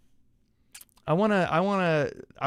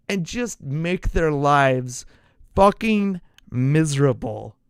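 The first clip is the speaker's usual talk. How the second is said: louder and slower, dragging each word out; emphatic slow build; 6 dB louder, slower than usual. Real, not acted. Fan hyping the rival game, slow and louder. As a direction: grind it out like a threat. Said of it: "I'm getting excited".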